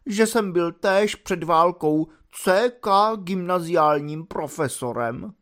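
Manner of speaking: tragic voice. Acted. Dub declaring his exhaustion to the officers, hammed-up tragic. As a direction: play the dying martyr. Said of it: "řekl tragickým hlasem".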